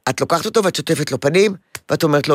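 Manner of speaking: ordinary delivery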